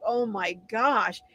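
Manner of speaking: ordinary talk, nothing marked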